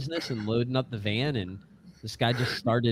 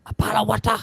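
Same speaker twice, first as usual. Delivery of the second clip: higher, hammed-up British accent; mock British accent; 8 semitones higher, 3 dB louder, much faster than usual. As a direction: parody a British accent, pitched up. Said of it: "that Disturbed thing where it's".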